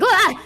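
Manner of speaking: high startled scream; startled shriek. Acted. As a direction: yelp in fright, high and loud. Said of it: "this creature that came downstairs like".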